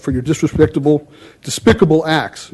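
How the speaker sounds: ordinary talk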